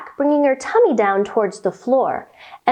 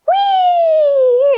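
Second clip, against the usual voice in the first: very high, loud happy squeal; joyful little mouse shriek; 5 dB louder than usual. Acted. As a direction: squeal it like a slide ride. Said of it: "The mouse said".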